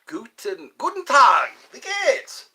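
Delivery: loud, high-pitched German hello; exuberant German greeting. Pretend. Streamer greets a viewer in German, loud and exuberant. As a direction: call out a German greeting with gusto.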